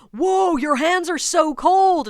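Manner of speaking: high, loud, drawn-out amazement; over-familiar stranger. Retold or imitated. Stranger shaking the guide's hand, gushing. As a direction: imitate a gushing man, slow and loud. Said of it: "And he's like".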